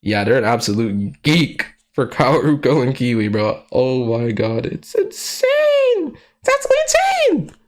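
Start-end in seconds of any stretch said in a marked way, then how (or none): 4.7-7.6 high squeaky gushing; excited squeal. Real, not acted. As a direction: squeal it like a delighted fan. Gushing over fanfic.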